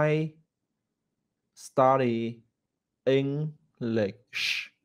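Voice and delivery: "I study English" spaced out word by word, ending with a clear sh; careful demo with pauses; slow, word by word. Shown as a demonstration. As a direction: model each word apart, slowly. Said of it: "nó phải như vậy".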